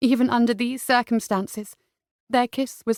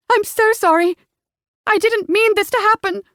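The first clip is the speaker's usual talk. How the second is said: high, loud, tearful woman's voice; distraught; 11 semitones higher, 5 dB louder than usual. Acted. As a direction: plead through her guilt. Distraught woman after the shooting.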